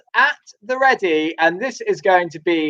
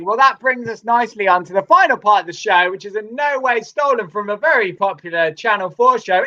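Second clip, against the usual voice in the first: ordinary delivery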